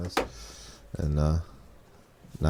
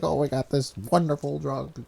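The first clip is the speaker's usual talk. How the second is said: lightly mimicked salesman politician, higher and softer; a light impression touting a miracle; Donald Trump; 4 semitones higher, 5 dB quieter than usual. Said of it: "Can you do that impression again?".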